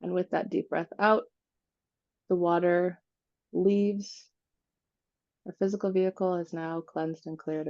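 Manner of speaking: unhurried meditation voice with long pauses; guided meditation. Acted. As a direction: guide them gently through it, phrase by phrase, leaving space to breathe.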